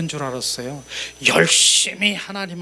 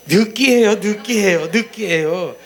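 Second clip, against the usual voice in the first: mock complaint; 5 semitones higher, 5 dB louder than usual. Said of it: "뭐라 그랬다?".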